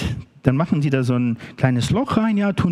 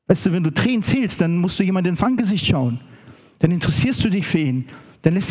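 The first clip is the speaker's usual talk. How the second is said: fervent preaching; 6 semitones higher than usual. Acted. Preacher building to fervent pitch.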